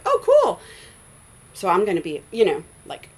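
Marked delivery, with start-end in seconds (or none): 0.0-0.6 put-on high, loud burst of childlike delight; excited kid. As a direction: react like a kid who's just learned a trick. Retold childhood delight at discovery.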